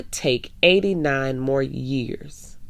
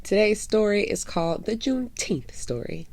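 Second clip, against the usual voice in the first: story time host; 5 dB quieter than usual. Acted. Host welcoming young listeners to story time, cheerful greeting voice. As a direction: open the show like a cheerful children's story host.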